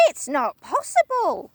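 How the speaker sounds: a hint of a frightened old wife; Missus Twit, denying. Wife refusing to believe, anxious. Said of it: "cried Missus Twit".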